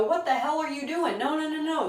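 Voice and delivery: high-pitched, quick mock outrage from naysayers; mock alarmed protest. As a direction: imitate disapproving people objecting, pitched well up. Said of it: "The lower people in your life are gonna go".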